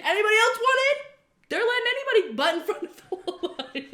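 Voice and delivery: high, loud sarcastic announcement; mock public shout. Clubber at the rope inviting line cutters, sarcastic shout. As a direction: call it out over the crowd, high and loud.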